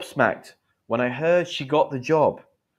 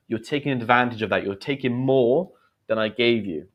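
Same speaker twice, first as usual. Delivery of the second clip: usual speaking voice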